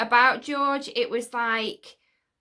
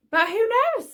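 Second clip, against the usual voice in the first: lilting, high and louder; high who-knows; 10 semitones higher, 5 dB louder than usual. Real, not acted. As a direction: toss it up high, loudly. Throws question open after theory.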